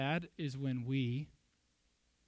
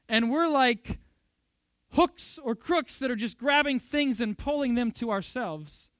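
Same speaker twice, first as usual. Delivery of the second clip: put-on crotchety old man; 5 semitones higher, 10 dB louder, slower than usual. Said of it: "Crotchety old man".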